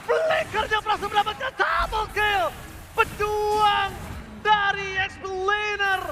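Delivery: fighting game announcer. Acted. Live play-by-play casting.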